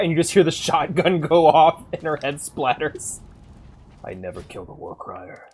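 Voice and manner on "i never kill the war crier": lightly voiced Irish accent, low and soft; a hint of an Irish lilt, said low; quiet mock Irish vow. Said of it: "Why is he Irish?".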